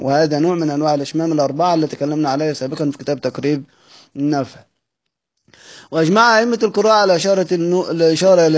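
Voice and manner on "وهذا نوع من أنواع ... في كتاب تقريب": ordinary talk, nothing marked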